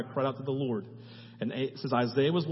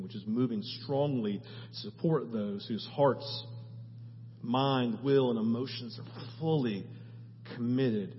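closing prayer; 2 semitones lower, 3 dB quieter than usual. Acted. Pastor leading the church in closing prayer, soft and earnest. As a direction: pray quietly and earnestly over the congregation.

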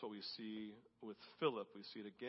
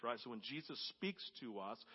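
ordinary talk, nothing marked

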